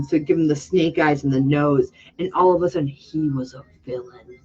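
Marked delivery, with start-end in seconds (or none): 2.9-4.4 whisper. Acted. Dramatic reveal of villain look.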